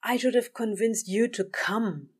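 lightly voiced weary sigh; a hint of an exasperated sigh; sighing demon voice. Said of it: "Crowley sighed louder".

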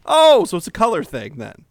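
high, loud indignant gasp; mock outrage. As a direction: feign shocked offence. Skit mock accusation again.

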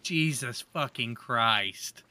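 ordinary talk

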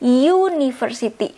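"university" with a lengthened "yoo" at the start; y sound in front. Shown as a demonstration. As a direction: model "university" as "yoo-niversity". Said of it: "Karena ada y ini ketika kita baca".

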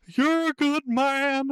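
high, loud wrestler catchphrase; slow, hammy wrestling promo. Pretend. Wrestling catchphrase tribute shout.

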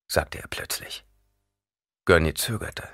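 ordinary delivery, nothing marked

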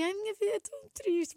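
high, half-joking moan of sadness; mock sad lament. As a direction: sigh it out as a fake tragedy, tongue in cheek. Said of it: "mas meio a gozar".

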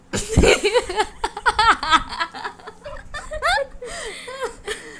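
long helpless laugh; high, breathless crack-up; high burst of laughter. Real, not acted. Big laugh at the pun.

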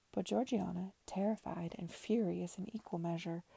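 usual speaking voice

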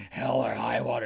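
put-on disapproving voice; mock religious man. Religious man objecting to a film title, mock disapproval. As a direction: imitate a pious objector saying the title. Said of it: "if he was a religious person".

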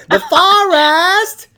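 "forest" high, loud and stretched as FAH-rast; incredulous, laughing blow-up of "forest" into a slow "FAR-AST"; mocking mispronunciation. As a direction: hammer "forest" high and drawn out, mimicking her broad A. Louder mocking repeat of her pronunciation.

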